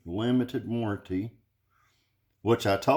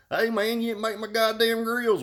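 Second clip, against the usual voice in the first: mock complaint; 12 semitones higher than usual. Retold complaint to Dakota.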